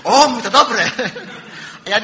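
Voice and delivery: laughing through the official's words. Real, not acted. Preacher retelling the official's reaction, laughing.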